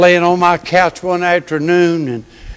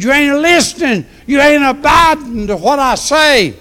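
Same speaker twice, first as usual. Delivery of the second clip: God rebuking; 8 semitones higher than usual. Retold or imitated. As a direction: call him out like the Lord. Voicing God's rebuke.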